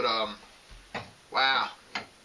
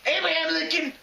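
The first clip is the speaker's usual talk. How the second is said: angry shouting; 11 semitones higher than usual. Acted. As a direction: shout about losing the war. Frustrated anchor rants about losing war.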